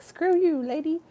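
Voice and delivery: high-pitched sassy cat voice; high, mock-indignant little kitty; cat's retort. Runaway kitty telling off the owner, high sassy voice. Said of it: "She's like".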